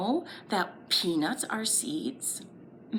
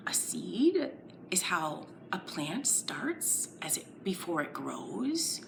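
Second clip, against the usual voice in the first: hushed explaining; 5 semitones lower, 8 dB quieter than usual. Acted. Teacher explaining seeds softly to children. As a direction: softly, close and confiding.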